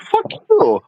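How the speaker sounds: very high, drawn-out comeback; exaggerated high-pitched defiance; mock defiance. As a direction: sing out a squeaky retort, hammed-up. Retold defiant reply to friend.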